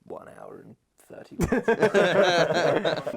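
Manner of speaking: loud, slow, laughing trailer boom; trailer voiceover. Pretend. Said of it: "a movie trailer voiceover".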